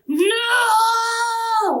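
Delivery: long, high, wailing scream; devil's defeated scream. Retold or imitated. Devil banished by the light, long wailing scream. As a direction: hold one drawn-out high howl. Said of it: "like that from him".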